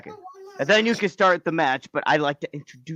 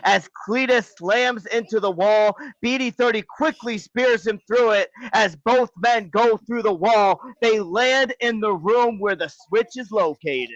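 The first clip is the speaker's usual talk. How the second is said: higher, driving match narration; wrestling commentary; 5 semitones higher than usual. Acted. Co-host narrating a wrestling match to listeners, commentary style. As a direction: call the action like a ring announcer.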